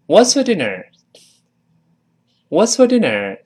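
"What's for dinner" with a weak "for"; model repeat. Shown as a demonstration. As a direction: repeat it fluently with reduced "for". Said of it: "再来一遍". (再示范一遍)

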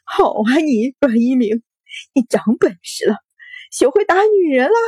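crying, bitter taunt climbing high; tearful accusation. A narrator's reading. Wife shoved by her husband, tearful accusation. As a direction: wail the reproach, pitch soaring at the end. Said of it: "白着脸哭道".

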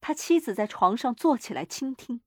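plain reading voice

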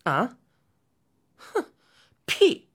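incredulous huff, then a high burst; scoff, then explode on the last word; fuming. A narrator's reading. Chang'e scorns her husband's boast, fuming. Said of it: "嫦娥更冒火了".

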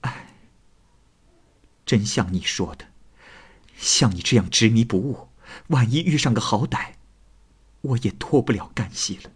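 resigned sigh, then softened reproach. A narrator's reading. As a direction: sigh and speak with weary resignation. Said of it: "叹息着说". (叹息着无奈地说)

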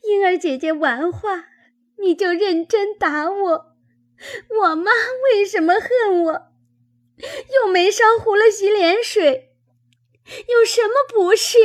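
high-pitched wailing protest; tearful crying. A narrator's reading. Beaten Chunyan protesting to her aunt, crying in a high wail. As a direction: sob the words out, very high and aggrieved. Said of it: "因哭道".

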